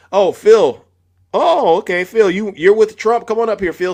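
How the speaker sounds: calling out. Real, not acted. Host calls Phil up as a guest, calling out.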